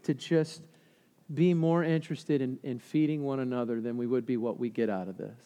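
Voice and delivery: soft, low, humble prayer; hushed retelling of someone praying; retold prayer. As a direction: quote the prayer quietly, almost to yourself. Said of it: "he was praying in light of".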